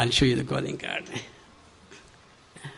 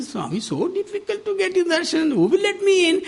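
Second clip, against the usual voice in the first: high pleading; 14 semitones higher than usual. Retold or imitated. Anxious plea to Swami.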